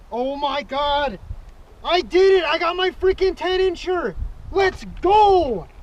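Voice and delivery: real joy at the catch; triumphant cheer. Real, not acted. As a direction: celebrate like you just won. Celebrating catching ten inch fish.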